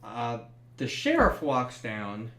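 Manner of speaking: ordinary delivery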